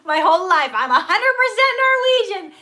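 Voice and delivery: high, loud outburst through laughter; laughing, high-pitched protest; loud exclamation. Real, not acted. Exclaiming over shattered ancestry boast.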